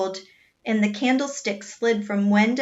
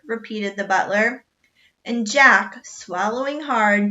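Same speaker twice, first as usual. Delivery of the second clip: ordinary delivery, nothing marked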